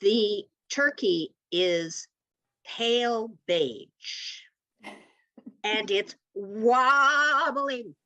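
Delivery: very slow, drawn out; slow suspenseful telling; slow dramatic build. Real, not acted. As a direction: drag each word out for suspense.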